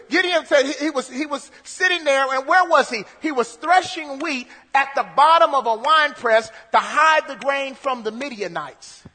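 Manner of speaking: ordinary talk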